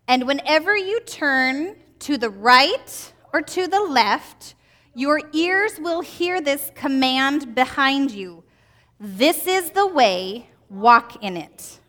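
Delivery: slow, measured recitation of a Bible verse; slow scripture reading. Shown as a demonstration. Teacher reading the key verse aloud to the kids, slowly and clearly. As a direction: read the verse slowly and deliberately, as children follow. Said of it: "So read it with me".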